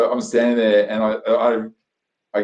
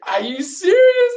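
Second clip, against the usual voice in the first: very high, loud, stretched-out astonishment; retold disbelief; 5 dB louder, slower than usual. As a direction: exclaim it slowly, stunned by the news. Retold reaction to his ranking.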